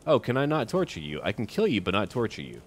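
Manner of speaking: normal talking voice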